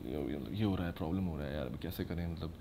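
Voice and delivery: hushed worried grumble, quick; mock worried mutter. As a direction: imitate a nervous actor muttering to himself. Mimicking worried co-actor.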